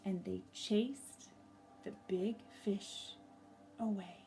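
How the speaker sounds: gentle storybook narration with lively swells; reading to children. A narrator's reading. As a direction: tell it like a picture book read to a class.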